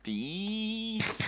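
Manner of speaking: high startled shriek; startled yelp. Real, not acted. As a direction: yelp as the bees sting. Bees attacking again.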